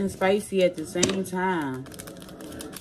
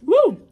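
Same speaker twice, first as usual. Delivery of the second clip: loud high whoop from the heat; spicy whoop; 12 semitones higher, 10 dB louder than usual. Real, not acted. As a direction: yelp at the burn. First bite of spicy noodles.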